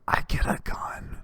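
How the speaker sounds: slow, gasping whisper of disbelief; awed whisper. Retold or imitated. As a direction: imitate an overwhelmed kid whispering. Retold awestruck child offered gun.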